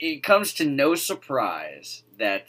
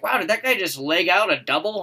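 mock amazed fan; 9 semitones higher, 7 dB louder than usual. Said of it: "You're like".

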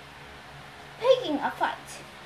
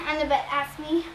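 plain reading voice

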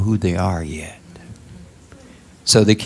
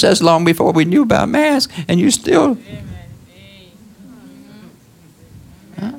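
preaching at full force; 10 semitones higher than usual. Acted. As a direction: preach it up to the congregation.

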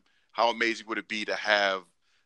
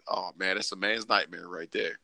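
normal talking voice

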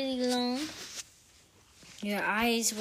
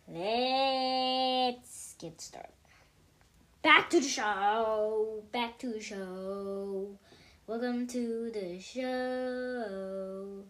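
slow, drawn-out host announcement; show host intro; 3 dB louder, much slower than usual. Acted. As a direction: stretch it like a show opener.

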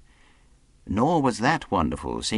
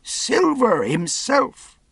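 high, loud astonished cry; cry of surprise; 5 dB louder, much slower than usual. A narrator's reading. Lookout spots Silver himself, cry of surprise. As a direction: exclaim it slowly in amazement. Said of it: "with a cry of surprise".

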